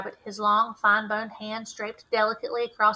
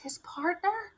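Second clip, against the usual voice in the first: shocked whisper, breathless; gasped whisper; 9 semitones higher, 5 dB quieter, slower than usual. Acted. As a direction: breathe it out in alarm. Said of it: "Miss Rose gasped".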